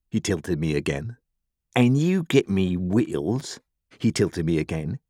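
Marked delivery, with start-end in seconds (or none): 1.7-3.6 slow, higher, drawn-out order; threatening convict. A narrator's reading. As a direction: spell out demands to a captive child.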